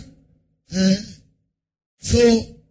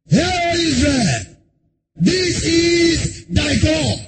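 drawn-out, slightly raised proclamation; proclaiming to Israel; 4 semitones higher, much slower than usual. Acted. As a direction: announce it to a crowd, stretching each word. Aaron proclaiming the calf to Israel.

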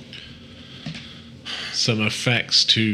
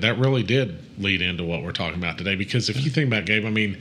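normal talking voice

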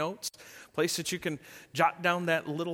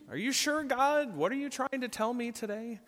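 much higher, incredulous asking of God; doubtful prayer; 8 semitones higher than usual. Retold or imitated. Pastor imitates himself questioning God, higher and incredulous. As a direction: play yourself doubting God's nudge, lightly. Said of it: "I've done that more than a few times with God".

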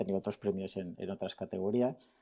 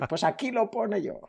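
high mocking guest voice; pitched up, teasing a stubborn guest; a guest insisting he read it, mimicked in a high voice; 11 semitones higher than usual. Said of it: "estábamos ahí".

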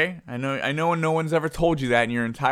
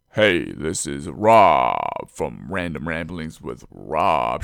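very low, slow radio-host imitation; Rob; 10 semitones lower, slower than usual. Imitating a buddy introducing his podcast, deep slow voice. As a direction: drop deep and stretch the names out slowly. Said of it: "letting me imitate your voice".

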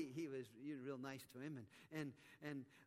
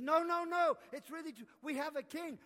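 high, loud no no no; insistent protest; 9 semitones higher, 10 dB louder than usual. Acted. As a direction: convince the doubting king, loudly. Advisors insist it is true.

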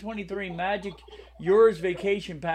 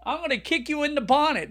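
mock British tough guy; 6 semitones higher, faster than usual. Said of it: "when the British people act, talk tough to you".

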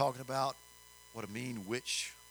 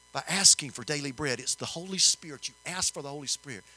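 full-force preaching; 3 semitones higher, 5 dB louder than usual. Acted. Preacher urges listeners to ask for daily bread, loud and fervent. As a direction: preach it out to the room.

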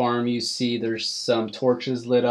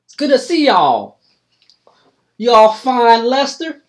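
hillbilly farmer; 10 semitones higher, 12 dB louder than usual. Acted. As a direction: greet them like an old country farmer. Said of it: "Like a hillbilly".

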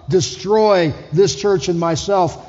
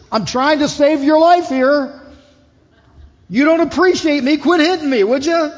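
talking donkey; 8 semitones higher, 4 dB louder than usual. Acted. The donkey complains to Balaam who keeps hitting it. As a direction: plead as the wronged donkey, loud and high. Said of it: "the donkey turns and looks at him and says".

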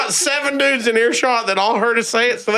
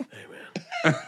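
hushed, voiceless acknowledgement; retold whispered greeting; 5 semitones lower, 11 dB quieter than usual. Man greeting someone in the elevator, whispered. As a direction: whisper it under your breath.

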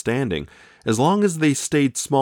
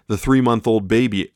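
plain reading voice

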